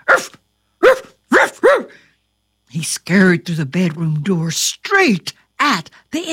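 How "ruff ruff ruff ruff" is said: loud barking like a dog. Acted. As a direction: bark it out, high and loud. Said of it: "instantly up and barking".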